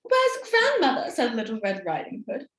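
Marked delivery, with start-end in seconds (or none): 0.0-1.1 put-on girl's voice. Girl asks Will.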